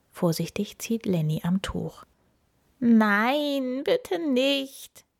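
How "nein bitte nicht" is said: higher whimpering plea; wailing plea. A narrator's reading. Paul hiding under the towel, whimpering plea. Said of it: "jammert es darunter".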